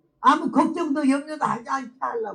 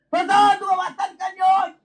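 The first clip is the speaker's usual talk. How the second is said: loud call; 9 semitones higher, 5 dB louder than usual. Retold or imitated. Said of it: "대답을 안 해".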